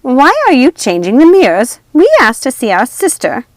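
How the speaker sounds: loud, high accusing witch voice; angry witch. A narrator's reading. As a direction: accuse the princess. Ruby angry at Aurora.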